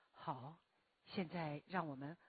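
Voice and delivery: low, soft, sinking voice for guided sitting; meditation-leading voice. Shown as a demonstration. Teacher demonstrating how she leads meditation, low and soft. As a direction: let the voice sink down and settle. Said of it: "我在带静坐的时候".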